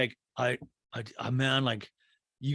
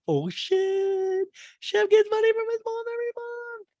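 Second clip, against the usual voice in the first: very high, held squeal of scandal; mock astonishment; 3 dB louder than usual. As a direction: squeal the gossip on one high note. Her amazed reaction to the revelation.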